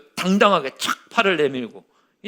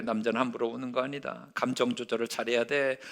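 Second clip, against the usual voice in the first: low, much softer stern father's lesson; a hushed, lower father laying down the rule; 5 semitones lower, 9 dB quieter than usual. Retold or imitated. Father teaching his son, in a low hushed stern voice. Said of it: "이런 식으로 얘기를 하는 거예요".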